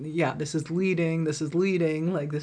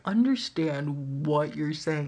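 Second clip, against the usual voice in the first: usual speaking voice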